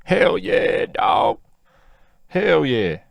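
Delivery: loud, high-pitched retold whoop; exhilarated yelling. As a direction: yell it like you're reliving the freefall rush. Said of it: "I couldn't stop yelling".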